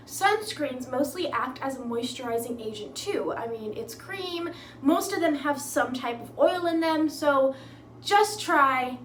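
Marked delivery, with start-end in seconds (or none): none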